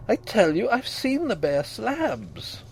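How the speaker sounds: high, slow, desperate insistence; frantic insistence. A narrator's reading.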